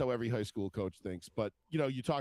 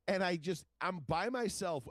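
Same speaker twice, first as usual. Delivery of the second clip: ordinary talk, nothing marked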